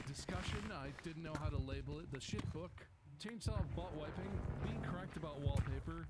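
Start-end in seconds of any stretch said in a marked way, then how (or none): none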